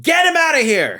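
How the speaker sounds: high, loud comic yell; mock shout. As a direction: shout for him to be thrown out. Joke command to throw him out.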